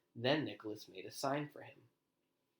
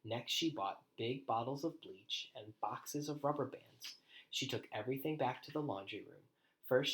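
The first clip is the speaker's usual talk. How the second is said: usual speaking voice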